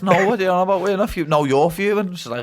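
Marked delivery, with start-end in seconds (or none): 0.0-1.3 mock exasperated denial. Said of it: "We're like". 1.3-2.1 mimicking the mate. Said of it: "We're like".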